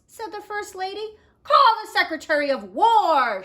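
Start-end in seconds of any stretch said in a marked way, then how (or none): 1.4-3.4 loud command; shouted. Acted. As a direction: shout the order down the hall. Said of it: "shouted President Taft".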